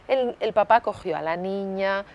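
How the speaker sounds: usual speaking voice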